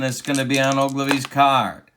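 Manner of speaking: ordinary delivery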